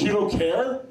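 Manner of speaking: laughing take on a startled reporter; mock surprised interviewer. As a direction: toss the question back in amused surprise. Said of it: "she goes".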